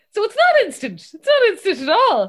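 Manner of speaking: high, loud mock outrage; retold anger. Dad feeling misled about Instagram, loudly indignant. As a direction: protest it loudly, imitating an angry dad. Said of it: "so angry".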